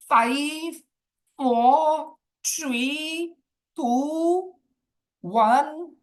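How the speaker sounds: high, sing-song count with pauses; drawn-out countdown. Real, not acted. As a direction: stretch each number up high, waiting for takers. Counts down waiting for questions.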